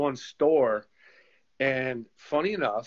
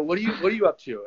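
mock innocent; 7 semitones higher, much faster than usual. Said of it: "I just rubbed it in".

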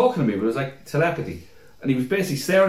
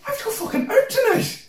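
high panicked whine. Retold or imitated. Retold panic at beer poured over.

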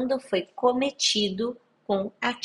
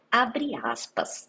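whispered aside; 3 semitones higher, much slower than usual. Shown as a demonstration. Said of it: "vou colocar aqui bem o tipo penal".

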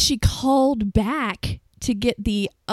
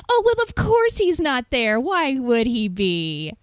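mock exasperation; 2 semitones higher, 3 dB louder than usual. Imitating caller told the owner is out, exasperated. Retold irate customer on phone.

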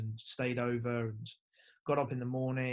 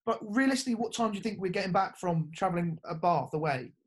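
higher, louder, fed-up explaining; exasperated reasoning; 6 semitones higher, 5 dB louder than usual. Retold or imitated. Player lecturing the coach about the late bus, higher and exasperated. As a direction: spell out the obvious in disbelief. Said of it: "I'm like".

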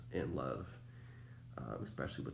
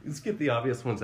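laughing; 6 dB louder than usual. Real, not acted. Laughing after a joke about knees.

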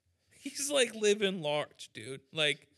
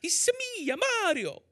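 Southern Mario. Pretend. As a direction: say it like Mario from Alabama. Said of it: "the Alabama of the Italian accent".

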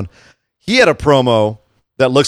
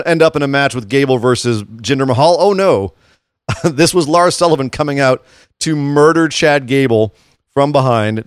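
ordinary delivery

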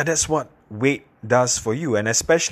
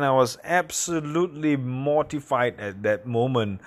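ordinary delivery

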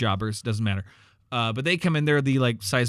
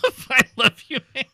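laughing; 13 semitones higher, 6 dB louder than usual. Real, not acted. Laughing while speaking.

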